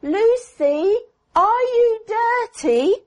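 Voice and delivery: put-on high child's voice asking the doll; child Mary voice. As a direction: play the girl quizzing her doll, high.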